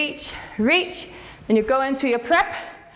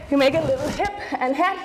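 normal talking voice

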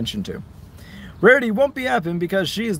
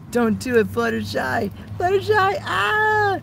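higher, pleading cry; high pleading pony voice; 13 semitones higher than usual. Acted. Acting out pony game fight.

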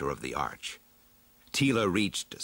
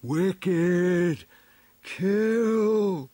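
high, loud, drawn-out creature cry; slow telepathic dinosaur wail; Stegosaurus thought-voice; 10 semitones higher, 5 dB louder than usual. A narrator's reading. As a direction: stretch each word out high and loud, like a beast's thought. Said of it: "From its mind came".

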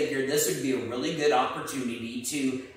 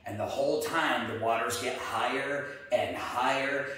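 usual speaking voice

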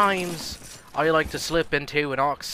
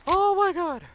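much higher squeal of disbelief; squealy oh my god; 3 dB louder, slower than usual. Real, not acted. Gamer squealing at a lucky escape. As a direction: squeak it out in shocked delight. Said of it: "I'm really squealy".

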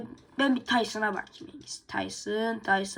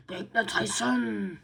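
ordinary talk

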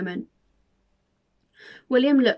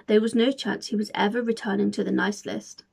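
ordinary delivery

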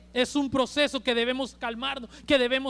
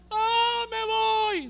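voz aguda y apagada, como quien se rinde; débil despedida fingida; 10 semitones higher, much slower than usual. Pretend. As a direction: imitando un suspiro resignado de moribundo. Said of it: "Pero no dijo". (Jesús que no dijo esto, imitado con voz débil y aguda)

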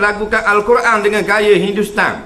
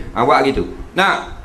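usual speaking voice

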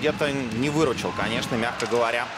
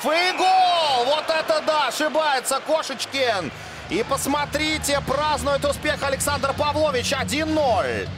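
excited goal call; 9 semitones higher, slower than usual. Real, not acted. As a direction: shout the goal, thrilled. Commentator calls a goal.

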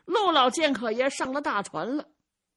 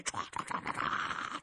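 whooshing sound; 10 dB quieter than usual. A narrator's reading. Imitating speedy running.

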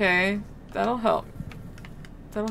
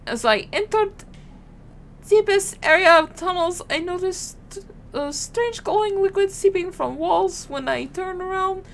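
higher-pitched, hammy French accent attempt; bad French accent; 8 semitones higher than usual. Soldier reporting strange liquid in tunnels, bad French accent. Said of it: "I can't do a French accent".

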